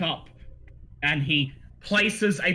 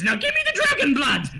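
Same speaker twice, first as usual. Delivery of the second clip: high, louder eager demand; 10 semitones higher, 4 dB louder than usual. Acted. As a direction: grab for the dragon blood. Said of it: "And he says".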